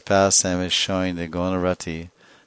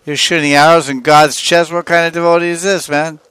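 loud, high-pitched indignant challenge; play-acted scandalized protest; mock outrage; 9 semitones higher, 10 dB louder than usual. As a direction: scold in hammed-up disbelief, pitch held high. Acted outrage at arrows shot at God.

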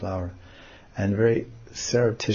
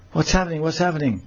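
falsely innocent asking; mock-innocent what's happening; 7 semitones higher, faster than usual. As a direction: play dumb, cool and calm. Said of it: "very cool and calm and innocently".

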